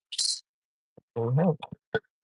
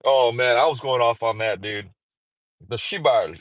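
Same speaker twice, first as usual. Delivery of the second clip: ordinary talk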